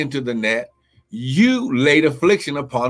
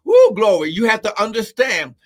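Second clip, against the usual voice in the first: preaching at full force; 8 semitones higher than usual. Acted. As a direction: climb into the preaching peak. Preaching climax continues.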